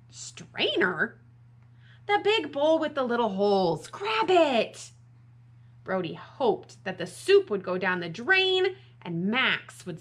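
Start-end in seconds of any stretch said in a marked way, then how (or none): 2.1-4.9 loud, raised-pitch instructions; storybook emergency command; urgent. Acted. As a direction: order a friend to hurry.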